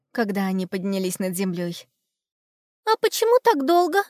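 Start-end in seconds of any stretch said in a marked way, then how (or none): 2.8-4.0 put-on very high girl's voice; little girl voice. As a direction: ask like a puzzled little girl. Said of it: "спросила девочка".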